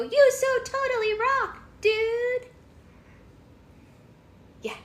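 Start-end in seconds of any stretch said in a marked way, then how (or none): 0.0-2.5 very high, loud, slow surfer-dude turtle. Acted. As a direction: squeal it like a stoked cartoon turtle. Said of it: "They go".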